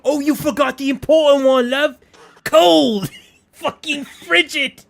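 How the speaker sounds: high put-on Cockney voice; mock Brit, high and loud; British accent. Mocking British food, says love.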